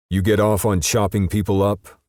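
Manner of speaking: loud angry growl. A narrator's reading. Justice growling at the bloody perp, loud and angry. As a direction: snarl the accusation at him. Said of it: "Justice growled at the fucker".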